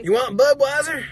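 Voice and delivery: mock Southern accent. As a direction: poke fun at the locals with a twang. Mocking locals' southern drawl.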